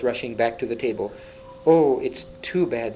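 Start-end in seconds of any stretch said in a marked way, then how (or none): none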